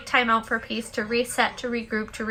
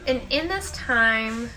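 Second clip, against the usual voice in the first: guided meditation; 4 semitones lower, 6 dB quieter than usual. Acted. Host leading listeners in the daily peace break, soft meditation voice. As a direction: guide them into stillness, unhurried and hushed.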